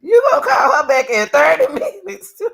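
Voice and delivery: loud, high, cracking with laughter; laughing disbelief. Real, not acted. Host replays the mother's words, laughing in outraged disbelief. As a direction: laugh it out, can't believe it. Repeating clip line in disbelief.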